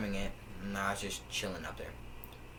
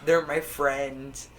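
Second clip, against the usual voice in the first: very slow, loud mock wail; escalated old lady imitation; 5 semitones higher, 9 dB louder, much slower than usual. Old lady insisting about her squirrel friends, loud drawn-out mock wail. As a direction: draw it out louder and higher in mockery. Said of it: "She was like".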